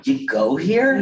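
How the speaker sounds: lightly hammed-up disbelief; mocking question. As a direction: ask it as if he doesn't belong. Mocking boys in a story.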